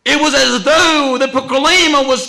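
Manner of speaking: loud, high-pitched dramatic narration; urgent preaching. Real, not acted. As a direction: hurl out the story at full force. Preacher's full-force climax.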